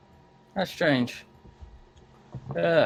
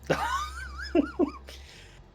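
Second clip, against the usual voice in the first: laugh. Real, not acted. Player reacts to deck of cards.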